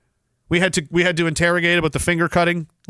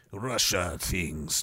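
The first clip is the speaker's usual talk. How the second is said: mock Russian accent; 13 dB quieter, much slower than usual. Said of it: "If the Russians called you".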